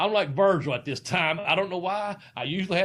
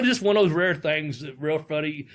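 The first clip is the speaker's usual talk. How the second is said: ordinary delivery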